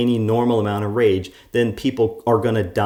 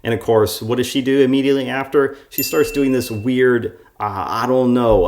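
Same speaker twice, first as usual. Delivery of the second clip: ordinary delivery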